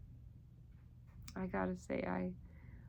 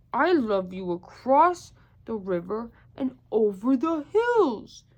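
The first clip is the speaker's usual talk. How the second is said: loud boast; 9 dB louder than usual. Acted. Big hare outdoes him again.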